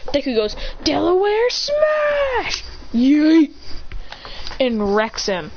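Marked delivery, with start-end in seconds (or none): 0.8-2.6 high, slow attack shout; high drawn-out battle cry. Acted. Hero calls attack name. 2.9-3.5 loud, punchy yeet. Acted. Attack hits.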